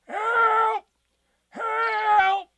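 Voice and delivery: very high, loud, long-held cry; calling for help. A narrator's reading. Tigger stuck up the tree, calling for help. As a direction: wail it out from far up. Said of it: "called out to them".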